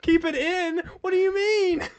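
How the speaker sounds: squeaky high frantic questions; panicked high voice. Acted. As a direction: go way up in pitch, panicking. Improv panic.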